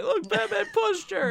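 very high, squeaky mock accusation; put-on high-pitched outcry; mock onlooker's cry. Mock onlooker cry in movie retelling.

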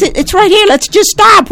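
urgent plea. Retold or imitated. Mother urging the driver to pull over, re-enacting an urgent plea. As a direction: re-enact an exasperated plea to stop. Pleading to stop at first hotel.

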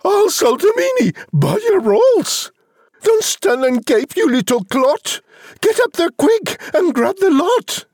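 very high, excited bossy mother; whoop, then order the boy about at high pitch; hammed-up high-pitched mum yell; yelling out loud. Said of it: "She yells out loud".

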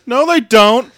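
loud, high-pitched comeback; mock retort. Wrestler answering her rival's taunt, mock loud retort. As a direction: throw it back high and loud. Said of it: "And Aaliyah says".

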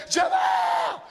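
high shrill yell out the window; mama screaming. Retold or imitated. Mama yelling for her son out the window, piercing. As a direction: call the kid home at the top of your lungs. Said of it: "my mama screamed out the window".

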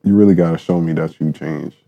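normal talking voice

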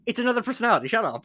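playfully fed-up comeback; exasperated retort. Acted. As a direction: snap back at silly doubters, rushed. Exasperated retort to imagined fans.

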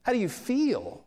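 high-pitched, mock-indignant questioning; incredulous challenge. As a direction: question it as if it's absurd. Acted indignant reply to worship leader.

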